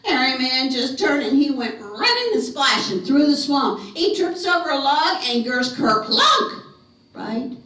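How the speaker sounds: loud, high, driving narration; rollicking storyteller climax; excited chase telling. A narrator's reading. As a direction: belt out the chase with rising energy.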